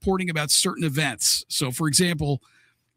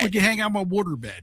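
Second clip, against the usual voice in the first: put-on Baltimore accent; 3 semitones higher than usual. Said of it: "the Baltimore accent".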